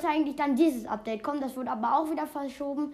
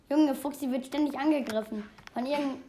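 normal talking voice

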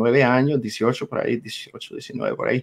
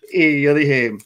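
normal talking voice

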